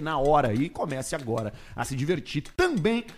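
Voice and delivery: upbeat sponsor read. Acted. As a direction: sell it like a radio ad.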